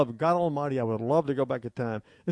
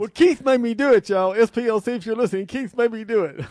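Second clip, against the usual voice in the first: mock accusation; 7 semitones higher, 8 dB louder than usual. Jokingly blames Keith on air.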